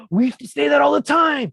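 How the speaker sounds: put-on shocked kids, high. Pupils protesting to their teacher, high and whiny. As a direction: squeal it like outraged teens. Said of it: "they said".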